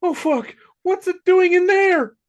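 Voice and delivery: high, loud frantic yell; high, slow terrified wail; panicked. Acted. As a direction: shriek it in horror. Freddy panicking in script.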